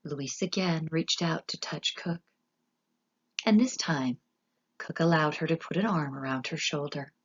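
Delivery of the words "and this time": ordinary delivery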